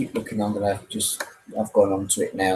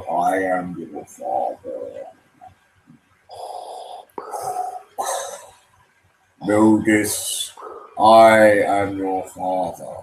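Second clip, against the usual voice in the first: low, slow Vader rumble; Darth Vader impression; 5 semitones lower, much slower than usual. Streamer attempts Vader for a compilation. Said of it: "I ain't got the proper deep voice".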